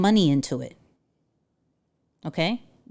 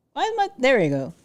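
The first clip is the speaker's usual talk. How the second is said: ordinary talk, nothing marked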